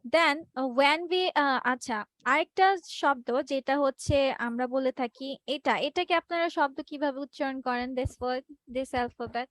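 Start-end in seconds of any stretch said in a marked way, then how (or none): none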